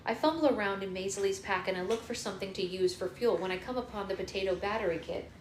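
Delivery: ordinary talk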